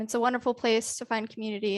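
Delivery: usual speaking voice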